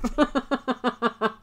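trailing run of laughter; laughing. Real, not acted. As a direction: chuckle on helplessly, dying away. Laughs at new fire.

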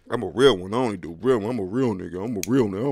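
loud, puffed-up bragging voice; mock tough-guy boast. Vlogger mocking people who brag that they're real, loud boast. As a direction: ham up a fake hard man.

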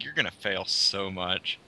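soft, hushed teasing voice; Will's voice. Acted. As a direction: needle him quietly like his conscience. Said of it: "I'm still using Will's voice".

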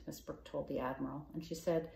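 ordinary delivery, nothing marked

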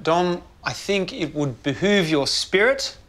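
usual speaking voice

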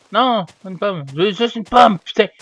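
high, loud fed-up shout ending in a curse; frustrated yelling. Real, not acted. Gamer cursing into his mic over an apple, frustrated shout. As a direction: yell it, fed up. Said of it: "faudrait que ça arrête de crier dans mon micro".

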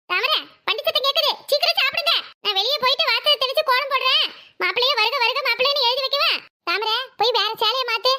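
high-pitched, urgent fluster; excited hurrying. Acted. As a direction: rush everyone as the guests approach. Hurrying everyone as guests arrive.

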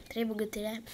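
usual speaking voice

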